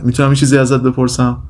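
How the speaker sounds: usual speaking voice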